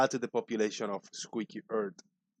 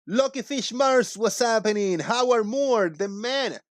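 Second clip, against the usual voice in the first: hype shout-outs; 9 semitones higher, 5 dB louder than usual. Acted. Hyping chat names.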